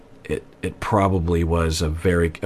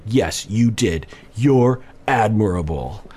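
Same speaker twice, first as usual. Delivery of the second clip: slow, mocking echo of his words; sarcastic mimicry; slower than usual. Mimicking the wife agreeing with him sarcastically, slow and mocking. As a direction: parrot it back, dripping with fake praise. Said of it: "repeat what he says in a sarcastic tone".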